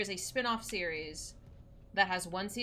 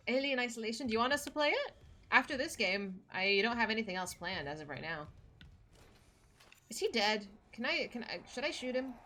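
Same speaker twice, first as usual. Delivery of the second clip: ordinary talk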